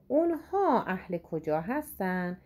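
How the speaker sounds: ordinary talk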